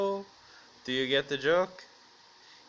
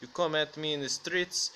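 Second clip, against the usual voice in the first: ordinary talk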